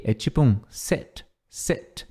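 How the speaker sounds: "sit" with a short vowel between i and e. Shown as a demonstration. Teacher demonstrating the short vowel in sit. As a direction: say "sit" with a clipped vowel, halfway to e. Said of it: "Detalhe na pronúncia do sit é que é um I curto".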